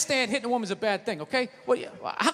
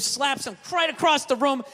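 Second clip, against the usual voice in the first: ordinary talk, nothing marked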